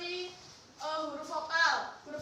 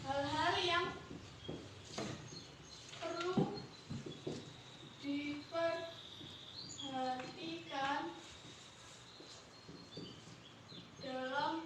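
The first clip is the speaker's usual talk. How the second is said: slow, word by word with long pauses; dictation; 9 dB quieter, much slower than usual. Real, not acted. Teacher dictating notes to pupils, slow dictation. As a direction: dictate it softly, leaving gaps for writing.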